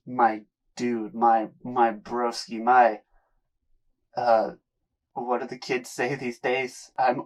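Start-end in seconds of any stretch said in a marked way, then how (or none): none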